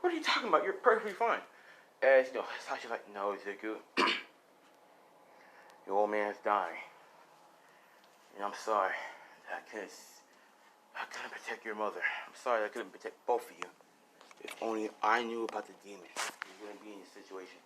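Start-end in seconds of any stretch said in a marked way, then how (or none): 0.0-1.4 put-on high-pitched, disbelieving child voice; son in denial. Son refusing to accept his dad is dying, high protest. 3.1-17.6 low, soft, regretful voice; dying father. Acted. Dying father apologising to his son, low and faint.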